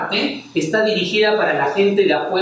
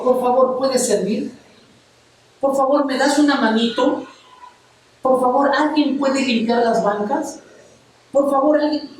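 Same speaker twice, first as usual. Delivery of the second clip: mock pleading, higher; a pastor playing Jesus begging for help, higher and pleading; 5 semitones higher than usual. Pastor mocks Jesus begging disciples to serve, higher and pleading. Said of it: "yo veo a Jesús rogando".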